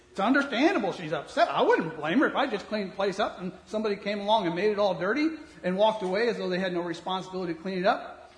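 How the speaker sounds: normal talking voice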